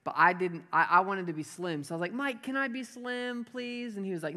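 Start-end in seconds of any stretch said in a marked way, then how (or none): 2.1-4.0 high, slow, soft mock begging; pleading little brother. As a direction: play a kid wheedling his big brother. Child self pleads with brother.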